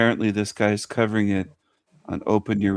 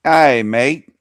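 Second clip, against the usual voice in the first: badly faked Australian accent; loud first try at an Aussie greeting; attempted Aussie accent; 6 dB louder, slower than usual. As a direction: have a clumsy go at sounding Australian. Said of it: "I can't do an Aussie accent".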